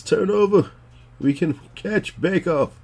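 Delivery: put-on high, loud old lady voice; cranky granny wanting the TV changed; old people voice. Shown as a demonstration. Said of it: "my old people voice".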